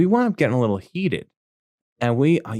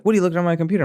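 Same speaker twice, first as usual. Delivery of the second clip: higher mock dad accusation; angry dad; 8 semitones higher, much faster than usual. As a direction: throw the question at him like a furious father. Dad yelling.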